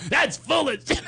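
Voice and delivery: high-pitched imitated outrage; hammed-up indignant shout; retold angry dismissal; mock yelling. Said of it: "He starts yelling at me".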